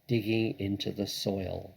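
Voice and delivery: ordinary delivery, nothing marked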